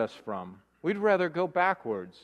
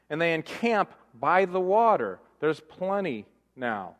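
ordinary delivery

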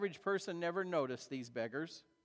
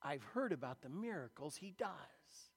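inner thought; 5 semitones lower, 7 dB quieter than usual. Retold or imitated. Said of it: "he thinks".

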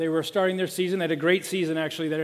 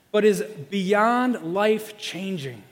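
slow emphasis; much slower than usual. Acted. Preaching emphasis.

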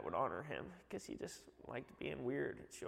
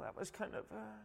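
ordinary talk, nothing marked

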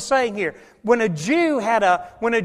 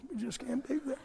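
whisper; 2 semitones higher, 16 dB quieter than usual. Retold or imitated. Whispered excuse repeated.

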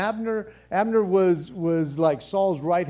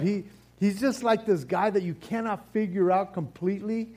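ordinary delivery

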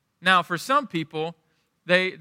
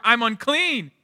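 higher-pitched, half-raised yell; mock yell; 6 semitones higher, 4 dB louder, slower than usual. Leper announcing himself in a grocery store, mock yelling. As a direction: call it out as a public warning, not full force. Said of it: "having to yell every time".

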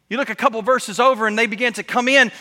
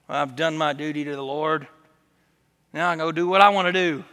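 low, soft, smug churchgoer voice; a lazy churchgoer ticking off duty, deep and hushed; mock churchgoer; 9 semitones lower, 6 dB quieter than usual. Pastor mocking lazy churchgoers, voice dropped low and soft. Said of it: "They punch the clock".

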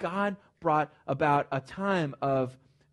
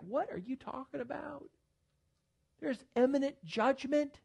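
incredulous retort; 9 semitones higher, 5 dB quieter than usual. Retold or imitated. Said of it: "And I was like".

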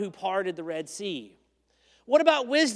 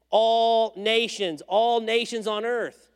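all nations drawn out slowly; slow down and press all nations; slow emphasis; 4 semitones higher, 6 dB louder, much slower than usual. Shown as a demonstration. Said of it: "here it is again".

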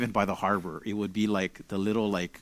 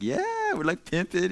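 cocky boast; 10 semitones higher, 4 dB louder, slower than usual. Retold or imitated. Speaker boasting at welcome.

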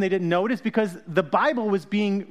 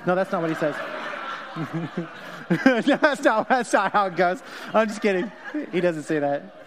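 laughing. Real, not acted. Laughing at own joke.

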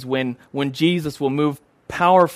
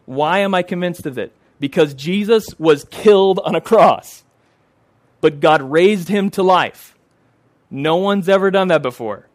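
louder, higher preaching with a slowed middle phrase; fervent preaching; 4 semitones higher, 4 dB louder than usual. Acted. Preacher telling his congregation why he's convinced, fervent. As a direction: lift it up like a pulpit climax.

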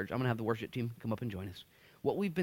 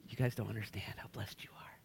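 secret whisper; 3 semitones lower, 9 dB quieter than usual. Retold or imitated. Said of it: "He's like".

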